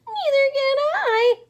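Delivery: very high, loud howl; loud high howling panic. Acted. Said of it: "howled Flitter".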